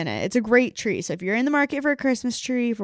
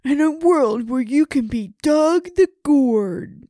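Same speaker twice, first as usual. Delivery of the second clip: movie trailer voice; 3 semitones higher, 5 dB louder, slower than usual. Pretend. Joins the trailer voice bit.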